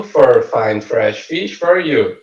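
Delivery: fine fresh fish said slowly and evenly; slow teacher demo of the twister; slow tongue twister. Shown as a demonstration. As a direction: carefully model "for fine fresh fish for you" at slow speed. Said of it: "Outro exemplo".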